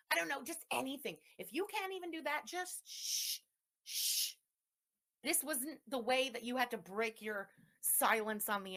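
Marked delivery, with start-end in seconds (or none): 2.5-4.3 a whispered shh; shushing. Real, not acted. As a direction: hush her with a breathy shh. Telling owner to be quiet.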